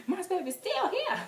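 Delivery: high, loud mock wail; hammed-up fangirl cry; distraught fan protest. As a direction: squeal your refusal to believe it. Acted fans protesting the announcement.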